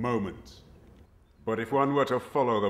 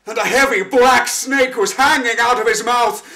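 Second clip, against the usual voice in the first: high, loud, frantic telling; horrified; 10 semitones higher, 12 dB louder than usual. Acted. The wanderer tells of the choking shepherd, horrified and loud. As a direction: pour out the horror as it unfolds.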